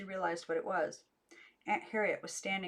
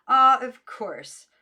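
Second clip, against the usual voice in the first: slow, loud, pleased "Ah"; drawn-out relief on finding it; relieved discovery; 6 semitones higher, 15 dB louder, much slower than usual. A narrator's reading. Distant voice finds item.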